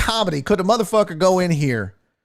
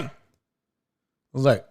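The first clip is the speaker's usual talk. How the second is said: ordinary delivery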